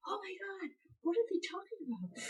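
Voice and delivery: high, hushed mock whine; exasperated whine. Labouring mother annoyed by chatting staff, exasperated whine.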